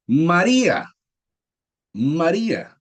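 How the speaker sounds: María said loud and high, stressing the í; stressed María. Shown as a demonstration. Teacher to class, modelling María with a stressed í. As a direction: sound out María clearly, leaning on the í. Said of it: "teacher models the word".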